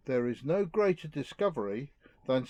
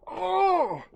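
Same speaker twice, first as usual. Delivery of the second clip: high, loud hammed-up yelp of pain; cartoonish cry of pain; mock pain yelp; 7 dB louder than usual. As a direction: yelp as if touching a sore spot. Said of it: "Like this".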